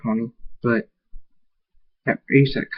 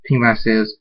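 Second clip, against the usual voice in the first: plain reading voice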